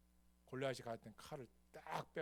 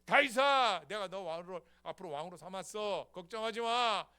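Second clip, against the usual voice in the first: loud, higher encouraging call; God reassuring David; 7 semitones higher, 14 dB louder than usual. Acted. God assuring David he will be king, loud. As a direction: lift him up, don't worry. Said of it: "두 번째".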